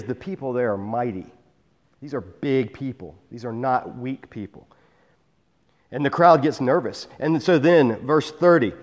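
ordinary talk, nothing marked

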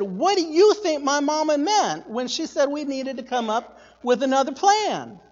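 high, eager public questioning; loud, nosy kid. Retold or imitated. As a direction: imitate a child quizzing everyone around. Child asking strangers aloud.

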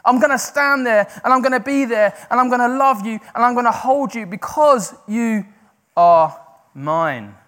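loud, high impassioned cry; screaming God's promise. Acted. Preacher shouting God's promise at his listeners, loud and high. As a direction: shout the promise over them. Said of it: "And he's screaming at us today".

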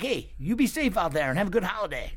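high-pitched chummy farewell; friendly send-off. Retold or imitated. Stranger sees off a fellow traveler, cheery. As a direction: imitate a stranger's cheery goodbye.

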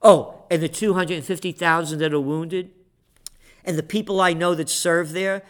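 usual speaking voice

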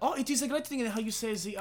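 high mock German accent with laughter. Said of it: "You have to say it in a German accent".

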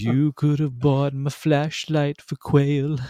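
high, slow silly sing-song voice; quoting a lyric. Retold or imitated. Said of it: "And then he says".

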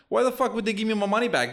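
ordinary talk, nothing marked

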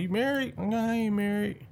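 high, squeaky back-and-forth; light imitation of a bar conversation; mock exchange. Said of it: "I'm like".

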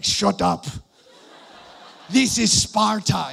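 hammed-up movie king bark, slowed down; Leonidas. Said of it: "I wanna look like Leonidas".

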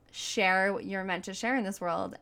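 ordinary talk

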